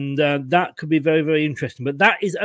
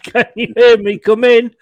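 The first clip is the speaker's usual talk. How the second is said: mock mission control; 5 semitones higher, 7 dB louder than usual. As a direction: hail him like ground control calling a lost astronaut. Host calling absent co-host.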